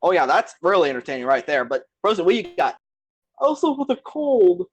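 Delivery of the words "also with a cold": high, slow mocking jab. Real, not acted. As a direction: sneer it out slowly. Mocking Frozen's plot.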